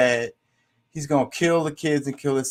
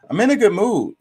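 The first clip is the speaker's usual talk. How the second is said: emphatic insistence; 7 semitones higher, 6 dB louder than usual. Real, not acted. Insisting she is fine.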